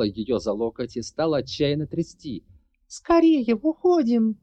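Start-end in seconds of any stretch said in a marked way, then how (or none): 2.9-4.4 high, loud urgent shout. Acted. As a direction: yell at her to run. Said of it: "кричала рыжеволосая Нира".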